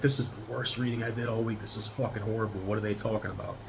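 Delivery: quiet disgust. Retold or imitated. Reacting to own bad reading.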